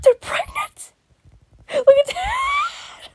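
high shocked squeal, slowing down; shocked shriek. Real, not acted. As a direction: shriek it out in horror. Shocked by a drawing.

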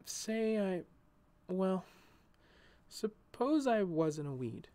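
tentative, with long pauses; hesitant. A narrator's reading. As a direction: feel your way into a shy question. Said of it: "She stopped and hesitated".

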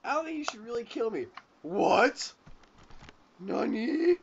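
high, loud put-on character voice; really high voice. Shown as a demonstration. Showing how the anime character would sound, really high voice. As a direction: pitch it way up, rising in surprise. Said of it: "use, like, a really high voice".

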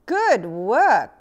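loud gleeful cry; loud cheering cry; cried out. A narrator's reading. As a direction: shout the praise. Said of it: "cried Little My".

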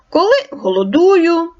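a touch of a hungry wolf; wolf character. Acted. Wolf voiced in tale.

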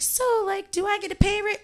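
high, small voice asking for a raise; timid ask. Retold or imitated. Asking the boss for a raise, timid high voice. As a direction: squeak out the request like a nervous kid. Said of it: "I'd be like".

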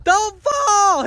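high loud yells of run; urgent shout. Real, not acted. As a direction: yell at them to run. Cow chasing, shouting run.

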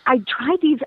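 normal talking voice

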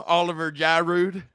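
laughing through a put-on country accent. Shown as a demonstration. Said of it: "say it with a country accent".

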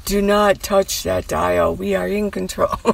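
slow, lightly voiced ominous announcer; a hint of a sci-fi TV narrator; Outer Limits announcer. Teller mimicking the old show's opening, slow and ominous. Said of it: "The Outer Limits".

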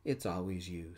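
ordinary delivery

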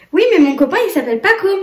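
put-on high, eager little boy. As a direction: play the excited toddler. Mother voicing her small son. (petite voix d'enfant)

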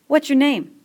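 worried schoolboy asking; fat boy voice. Acted.

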